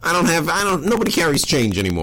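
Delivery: high-pitched stammered excuse; flustered pleading. Pretend. Man fends off a dog wanting a quarter, flustered. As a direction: plead your way out, pitch jumping up. Improv, pressed by threatening dog.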